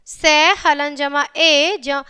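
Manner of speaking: slow, syllable by syllable; slow spelling out. Shown as a demonstration. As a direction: spell it out slowly for children. Teacher spells out letter by letter. (धीरे-धीरे अक्षर जोड़कर बोलना)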